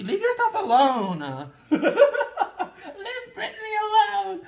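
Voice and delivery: high, loud, slow laughing wail; half laughing, half crying out. Real, not acted. To herself and viewers, a loud laughing wail. Emotional outburst with laugh and cry.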